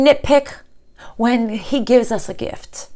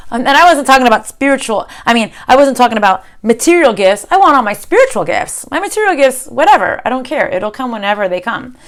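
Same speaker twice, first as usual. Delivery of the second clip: ordinary delivery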